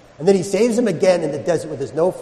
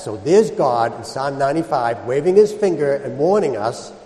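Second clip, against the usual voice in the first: ordinary delivery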